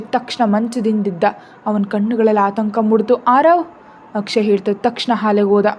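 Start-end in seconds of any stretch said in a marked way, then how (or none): 3.3-3.6 high, rising worried call; alarmed call. A narrator's reading. Father hears his child crying, alarmed call. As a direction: call out the name, pitched up in concern.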